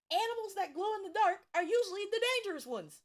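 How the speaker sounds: high, loud, fast and animated; eager, quick, high-pitched fact; excited. Real, not acted. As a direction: rattle it off excitedly.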